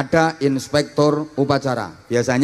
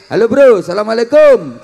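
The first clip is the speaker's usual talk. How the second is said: loose, friendly and louder, higher; casual street greeting; 5 semitones higher, 7 dB louder than usual. Shown as a demonstration. Greeting a friend met in the street, casual and loud. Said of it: "itu salamnya nggak kayak M C".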